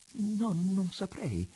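high, slow, faltering reply; stammering. A narrator's reading. Daughter pressed by her father to guess, stammering. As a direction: stammer it out slowly, pitched high. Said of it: "balbettò lei".

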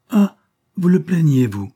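outraged mistress snapping at Vibert; indignant outburst. Acted. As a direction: hurl it at him in disbelief.

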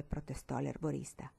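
plain reading voice

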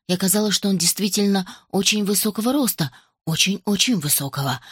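plain reading voice

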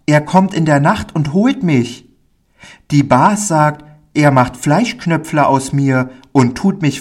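put-on high, naive child voice; timid little boy, worried. Pauli confesses to the man his fear of being taken, high worried child voice.

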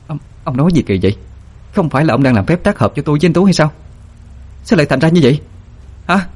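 fast, high-pitched panicked questioning; distraught disbelief. A narrator's reading. Hồng Ánh questions ông hai about the spell, distraught. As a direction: demand answers in rising panic.

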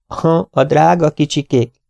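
high, fluttery spinster voice; fussy Miss Lawson. Acted. Miss Lawson offering soup to her guest, high and fussy. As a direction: voice her like a fussing old maid.

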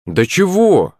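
high-pitched puzzled question; bewildered. A narrator's reading. Акулыч scolded for his prank, bewildered. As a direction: ask it in baffled innocence. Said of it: "растерянно улыбнулся Акулыч".

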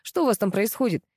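usual speaking voice

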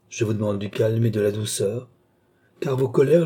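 ordinary delivery, nothing marked

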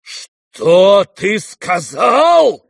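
hugely drawn-out, high, loud challenge; outraged slow roar; thundering shout. Acted. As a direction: thunder it, stretching every word. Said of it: "загремел генерал".

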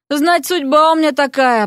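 usual speaking voice

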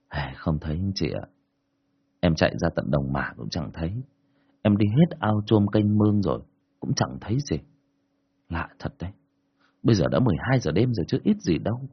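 low, soft worried uncle; lightly voiced uncle, low and hushed; uncle reporting. Uncle tells the parents the boy is missing, low and worried.

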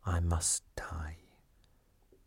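very slow, hushed whisper; murmur. A narrator's reading. Milady facing her execution, speaking to herself, murmuring. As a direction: whisper it slowly, as if resigned. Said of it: "murmura en anglais Milady".

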